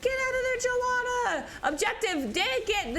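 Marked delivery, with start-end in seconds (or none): 0.0-1.5 high, pleading shout at the hero; urgent call-out. Real, not acted. Caster urging Joanna to flee, high and urgent. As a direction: plead with her to escape.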